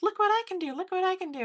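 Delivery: high, childish sing-out impression; put-on high-pitched show-off squeal; Stewart. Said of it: "whenever they had Stewart on there".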